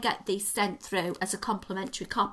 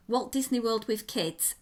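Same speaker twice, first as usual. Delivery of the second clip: normal talking voice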